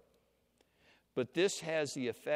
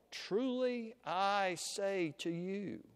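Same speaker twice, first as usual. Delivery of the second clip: slow, higher-pitched, measured declaration; teacher with authority; 5 semitones higher, slower than usual. Acted. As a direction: draw out each word as the new teacher's own pronouncement. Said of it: "He speaks with words like".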